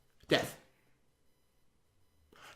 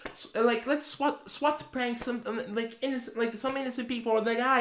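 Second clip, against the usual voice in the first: mocking kid voice; 7 semitones higher, 9 dB quieter than usual. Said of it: "decide, like".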